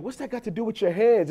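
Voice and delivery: high-pitched retold parental disbelief; incredulous. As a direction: challenge the daughter's excuse, baffled. Retells arguing with his daughter.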